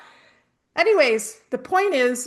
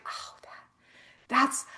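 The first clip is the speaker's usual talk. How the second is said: stunned whisper; 2 semitones higher, 8 dB quieter than usual. Retold or imitated. Stunned at falling skydiver.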